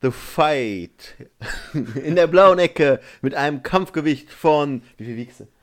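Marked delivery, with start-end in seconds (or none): 0.0-1.1 stretched-out boxing ring announcer call. Pretend. 1.7-4.8 slow, louder ring announcer. Pretend. Announcing fighter's weight.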